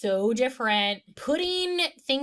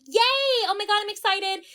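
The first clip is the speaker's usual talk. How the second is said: high-pitched imitated squeal of glee; playful excited cheer; mock excitement; 10 semitones higher, 4 dB louder, faster than usual. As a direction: gush like an excited date, pitched up. Retells her excited squeal.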